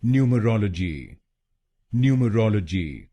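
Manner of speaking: न्यूमरोलॉजी with British "nyoo" start; British pronunciation. Shown as a demonstration. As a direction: say न्यूमरोलॉजी the British way, nyoo-muh-ROL-uh-jee. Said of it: "British pronunciation में इसको बोलेंगे". (British उच्चारण में न्यूमरोलॉजी)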